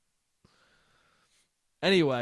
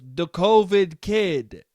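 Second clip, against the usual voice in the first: slow, drawn-out reveal, louder; lightly mocked dad; 2 semitones higher, 5 dB louder, much slower than usual. Son mimicking dad explaining his nickname, lightly mocking. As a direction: stretch it out like a proud punchline.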